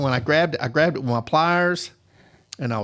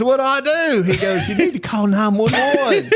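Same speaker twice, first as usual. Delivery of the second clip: panicked; 9 semitones higher, 3 dB louder than usual. Retold or imitated. Technician panicking voiced.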